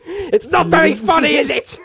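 slow, high prim teacher voice; lightly voiced embarrassed teacher scolding; mimicking the teacher's indignant line; mock teacher. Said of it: "Miss Madden stood up and was like".

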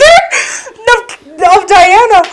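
very high, loud words through laughter; cracking up. Real, not acted. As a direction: lose it laughing mid-sentence. Cracks up mid-sentence.

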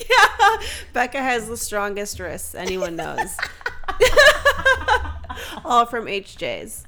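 talking through laughter; laughing. Real, not acted. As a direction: laugh while you say it. Laughing at hand job joke.

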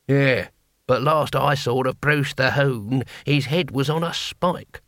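put-on slow, dry old warrior grumble; lightly voiced old barbarian. Old horde member to comrade, lightly voiced dry retort. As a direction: drawl it like a weary veteran.

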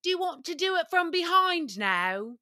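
a lightly put-on British voice; British accent. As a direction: imitate the British boyfriend politely asking. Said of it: "he would always ask".

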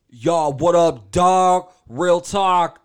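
deliberately bad impersonation, loud and high; hammed-up, very loud caricature; Obama. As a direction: belt out each phrase separately, slow. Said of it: "That's my Obama impression".

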